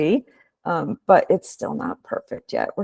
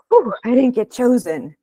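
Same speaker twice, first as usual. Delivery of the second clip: "Whew" sighed out high, then an easy relieved "I didn't get chosen"; 6 semitones higher than usual. Shown as a demonstration.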